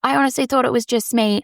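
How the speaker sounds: a local lady gushing over the podcast, high and bubbly; gushing fan. Retold or imitated. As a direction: gush like a starstruck fan. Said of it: "She goes".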